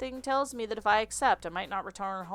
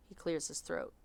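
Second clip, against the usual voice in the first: ordinary talk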